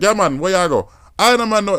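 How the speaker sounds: high-pitched imitation Geechee accent; African-sounding Geechee accent. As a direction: imitate a Sea Islands Geechee speaker. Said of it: "really, like, African accent".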